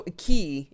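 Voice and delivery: aquí with the last syllable punched; "aquí" stressed at the end, ah-KEE; stress on final I. Shown as a demonstration. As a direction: hit the final "í" in "aquí" harder. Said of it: "the I gets the emphasis".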